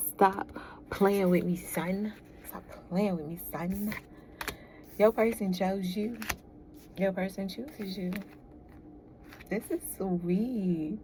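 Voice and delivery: ordinary delivery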